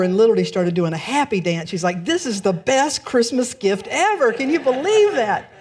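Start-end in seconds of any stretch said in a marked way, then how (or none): 2.0-5.5 excited. Retold or imitated. Happy dance quote.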